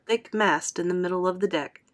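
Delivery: plain reading voice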